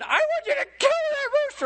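high, laughing mock sob of the pregnant wife; crying wife. Wife in tears begs husband to kill the rooster, mock-sobbing. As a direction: wail it like the tearful wife. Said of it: "she said".

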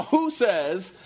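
ordinary delivery

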